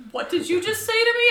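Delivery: high, loud bewildered question; baffled by a thick accent; retold confusion.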